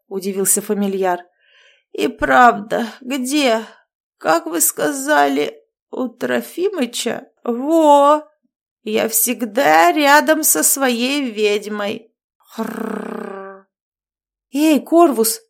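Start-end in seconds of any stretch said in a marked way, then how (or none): 1.9-12.1 put-on slow, slurred, giggling drunk. A narrator's reading. Drunk raven rambling. 12.4-13.7 snoring. A narrator's reading. Raven falls asleep snoring. 14.5-15.4 loud high call. A narrator's reading. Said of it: "позвала я".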